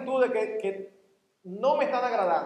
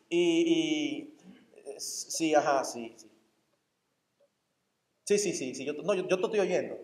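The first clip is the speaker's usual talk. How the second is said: distracted listener; 6 semitones lower, 8 dB quieter than usual. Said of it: "Usted ha visto cuando usted está hablando".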